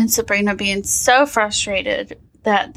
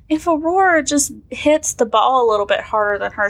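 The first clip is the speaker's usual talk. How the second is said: normal talking voice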